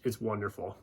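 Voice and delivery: ordinary delivery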